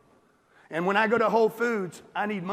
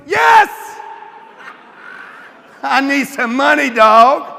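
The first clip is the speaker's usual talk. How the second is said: loud, laughing yes; excited shout; 5 semitones higher, 11 dB louder, slower than usual. Real, not acted. To the crowd, eagerly admitting he needs money, shouting with laughter. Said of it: "shouting his own need".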